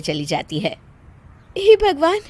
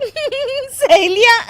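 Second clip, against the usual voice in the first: slow, high giggled question then cackling; laughing; 11 semitones higher, much slower than usual. Acted. Daughter-in-law hearing of friends' visit, bursting out laughing. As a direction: crack up on the word. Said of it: "तुम फिर से हंस रही हो".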